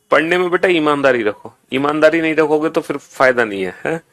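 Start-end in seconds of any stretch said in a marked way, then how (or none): none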